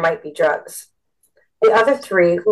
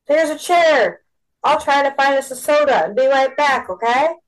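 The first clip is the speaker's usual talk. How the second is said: raised, high-pitched call over party noise; lightly acted yell; mild yell; 7 semitones higher than usual. A narrator's reading. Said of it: "when he yelled into her ear".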